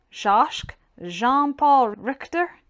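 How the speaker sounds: usual speaking voice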